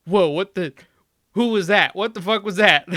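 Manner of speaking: loud, high mock disbelief; high, loud retold shock with a laugh; astonished reaction. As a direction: imitate someone having their mind blown. Acting streamers' astonished reactions.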